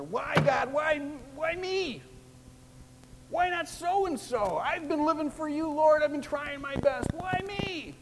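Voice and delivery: whining complaint. Retold or imitated. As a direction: plead to God like a sulking complainer. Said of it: "we complain and we murmur".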